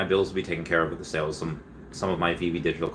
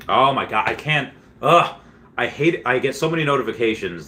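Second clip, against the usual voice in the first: exasperated; 5 semitones higher, 4 dB louder than usual. Real, not acted. Streamer fed up with too many notifications, exasperated. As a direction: groan of frustration, higher and louder.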